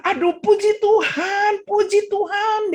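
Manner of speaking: high, loud, drawn-out exclamation of praise; overjoyed relief. Retold or imitated. Old man learns victim survived, overjoyed. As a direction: cry out thanks, stretching each phrase.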